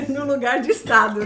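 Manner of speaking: laughing through the words; nervous laugh while talking. Real, not acted. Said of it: "dá até medo de errar".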